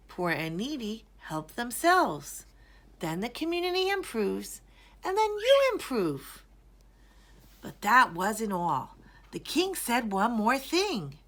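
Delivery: ordinary delivery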